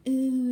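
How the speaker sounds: loud, high taunting "ooh"; retold sneering hoot; mock jeering ooh. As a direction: jeer it out like the rude customer. Mocking customer jeering at her.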